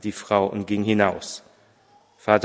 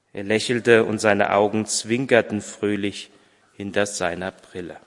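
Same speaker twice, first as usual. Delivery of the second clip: ordinary delivery, nothing marked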